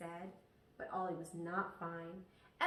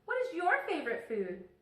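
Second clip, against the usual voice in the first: calling out; 8 semitones higher, 4 dB louder than usual. Acted. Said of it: "Ollie yelled".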